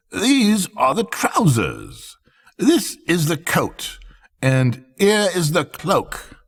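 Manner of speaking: high, slow salesman display; showy swindlers. A narrator's reading. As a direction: present each piece grandly. Swindlers presenting the clothes.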